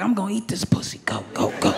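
hushed pep talk in a whisper; whispering to hype herself up like John Madden; huddle whisper. Retold or imitated. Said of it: "John Madden in the huddle".

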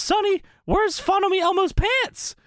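high-pitched puppet question; Elmo. Acted. Elmo looking down, asking Sonny, high squeak. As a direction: ask it in a squeaky cartoon voice. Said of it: "and says".

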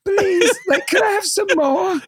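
high, whimpering mock plea; teasing, hammed-up falsetto begging; mocking brother. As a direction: plead slowly like a pitiful storybook orphan. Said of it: "my brother and sister would be like".

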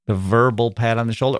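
ordinary delivery

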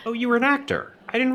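surprised. Acted. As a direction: act politely amazed, voice jumping up.

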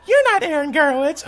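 high-pitched imitation of an accusing girl; mock-indignant high voice. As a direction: challenge him in a high female voice. Girl challenges him.

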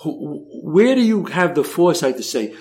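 usual speaking voice

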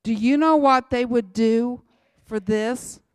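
loud, hammering question at the crowd; fiery preaching. Real, not acted. Preacher challenging the church, loud and fiery. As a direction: drive the question home loudly.

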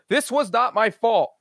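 "this was not my fault" read slowly, pitched well up on every word; all caps emphasis. Retold or imitated. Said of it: "in all capital letters".

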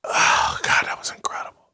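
sighed, breathy whisper of relief; blissful satisfaction. Acted. Host acting out viewers satisfied after the show, sighing whisper. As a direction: whisper it like a contented exhale after a cigarette. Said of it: "that you'll be like".

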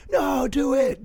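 high, strained imitation of a crowd; mock crowd demand. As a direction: urge it on like distant hecklers. Audience demands the accent.

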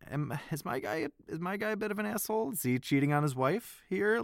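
high, doubtful question to yourself; incredulous inner thought. Retold or imitated. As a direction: wonder aloud, half-shocked at your own hero. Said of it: "that made me think".

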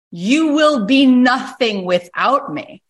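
cruel sneer. Retold or imitated. Boss sneering at Barbara as she walks out, slow and contemptuous. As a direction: spit it out like a boss who thinks he owns her. Boss's parting insult.

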